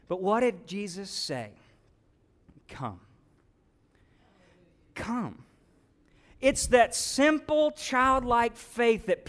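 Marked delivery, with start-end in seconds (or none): 2.7-5.4 very low, hushed single word; soft invitation. Acted. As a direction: breathe the word gently. Jesus' answer voiced softly.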